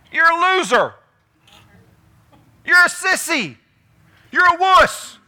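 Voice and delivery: shrill-pitched loud mock taunts from a scornful wife; mocking insults. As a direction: spit the insults to cut him down. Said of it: "That's what she was saying".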